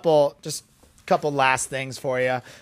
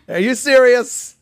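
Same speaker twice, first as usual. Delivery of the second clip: higher, louder incredulous question; mock disbelief; 9 semitones higher, 6 dB louder than usual. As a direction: ham up the shock. Disbelief at wash pronunciation.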